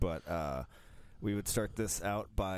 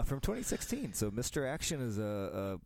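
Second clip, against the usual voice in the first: ordinary talk, nothing marked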